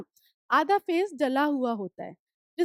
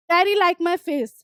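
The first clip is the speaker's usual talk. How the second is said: reading the story text slowly, word by word; slow reading aloud; 4 dB louder, much slower than usual. Acted. Teacher reading the story aloud to students, slow and clear. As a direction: read each English line slowly and clearly for the class.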